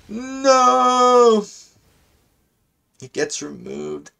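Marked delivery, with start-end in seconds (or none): 0.1-1.4 high, loud drawn-out cry; mock wail. Player protesting mid-game, long high mock wail.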